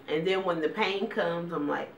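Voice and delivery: ordinary talk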